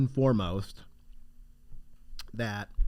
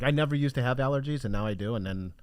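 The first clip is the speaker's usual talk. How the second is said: ordinary delivery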